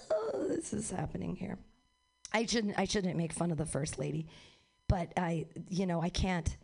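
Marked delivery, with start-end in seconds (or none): 0.1-1.6 soft, sexy lounge singer voice; mock sultry. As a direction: purr it lying on the piano. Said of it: "lean on it and be like".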